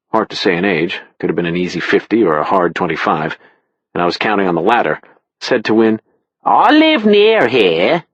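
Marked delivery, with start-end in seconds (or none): 6.4-8.0 flirty barmaid. Acted. Barmaid hits on Wynne.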